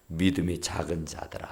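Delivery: soft whispered rebuke. Acted. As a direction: breathe the rebuke sadly. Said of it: "Jesus's rebuke quoted". (속삭이듯 꾸짖는)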